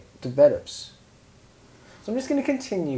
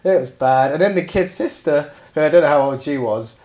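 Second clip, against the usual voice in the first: normal talking voice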